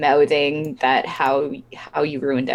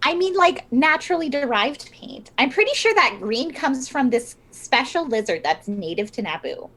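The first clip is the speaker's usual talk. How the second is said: high-voiced, eager explainer; defensive art seller; 9 semitones higher than usual. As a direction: explain it in a raised, put-on seller's voice. Plays Fiona selling the painting.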